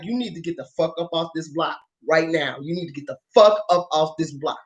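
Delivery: acted menace, held down and controlled; low menacing order. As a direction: drop the pitch and warn them off. Acted threat to clear the block.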